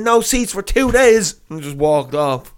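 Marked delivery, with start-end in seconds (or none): 0.0-1.4 high, loud, drawn-out jeer; retold childish taunt. Passenger jeers at airline staff before walking off, acted taunt. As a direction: stretch it out mockingly as a parting shot. Parting taunt, later called immature.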